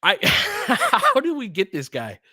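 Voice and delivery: high, slow, laughing disbelief. Real, not acted. Amazed by the rookie Dalton, laughing in delight.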